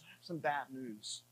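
very quiet, low retold confession; Christy breaking bad news. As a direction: break bad news softly, pitched well down. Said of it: "Christy turns right before she opens the door and says".

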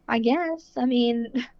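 high, laughing, half-hearted reply; awkward laugh. Retold or imitated. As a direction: laugh it off, unsure. Nurse offers to play heartbeat.

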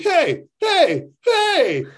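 high, loud calling-out voice; yelling guard. Retold or imitated. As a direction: shout it up high like a fed-up attendant. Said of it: "a guy who's like".

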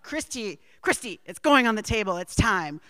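loud, urgent call from the kitchen; mom calling out. Retold or imitated. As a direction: holler it like a busy mother summoning her child. Acting out mom's dinner summons.

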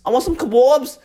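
lightly mocking British lad; mock Geordie partier. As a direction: chant it like a drunk Brit. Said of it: "They'd be like".

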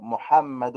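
محمد drawn out with an audible final -un; tanween pronounced. Shown as a demonstration. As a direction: say محمد as "mu-ham-ma-dun", slowly. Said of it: "دال، نون".

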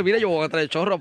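usual speaking voice

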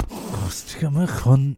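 filthy drawled British porn line; drawn-out parody of British porn talk; British porn accent. Acted. Co-host parodying British porn for a laugh, drawn-out filthy British voice. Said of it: "do your, the British thing".

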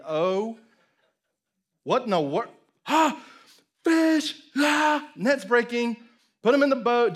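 high, loud panicked yelps; freaking out. Acted. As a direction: act out a fisherman in a flap. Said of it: "Peter's freaking out".

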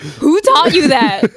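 surprised, amused. Retold or imitated. Grandchild reacting to grandma swearing in English, surprised. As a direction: act out startled disbelief. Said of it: "I was surprised".

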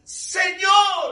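Señor shouted high and loud; Señor flung out as a high shout. Shown as a demonstration. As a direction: cry out Señor with full force. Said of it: "Noten cada palabra".

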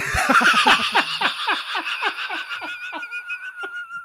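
long, high-pitched helpless laugh; real laughing fit. Real, not acted. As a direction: crack up at your own punchline. Cracks up at own punchline.

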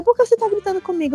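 high, quick indignant question; retold indignation. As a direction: mimic an affronted woman, fast and high. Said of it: "eu gritei com a mulher".

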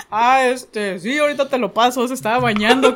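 stammering excuse with a laugh; flustered laughing reply. Retold or imitated. On the phone with a friend's dad, laughing nervously. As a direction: caught off guard, laughing through the answer.

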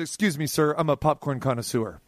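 low-pitched, deadpan self-importance; mock-dignified retort. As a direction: correct him like a self-styled expert. Mock-dignified retort to the carving guy.

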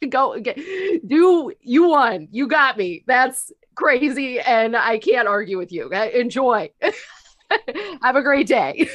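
high, loud words breaking into laughter; retold laughing surrender. Nurse concedes to the patient, laughing hard. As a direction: give up through helpless laughter. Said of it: "I started laughing so hard".

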